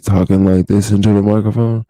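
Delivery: loud, too close to the microphone; mouth right on the mic. Shown as a demonstration. Vlogger showing the wrong way to talk into the mic, loud and too close. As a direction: lean in and blast the mic up close. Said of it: "like, I'm not like this".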